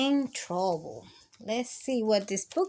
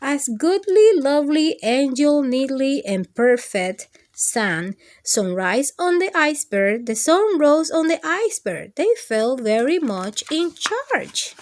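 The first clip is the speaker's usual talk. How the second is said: loud, lively storybook reading; loud read-aloud narration; story reading; 2 semitones higher, 6 dB louder than usual. Acted. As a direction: read it out big for the kids. Reads story aloud to kids.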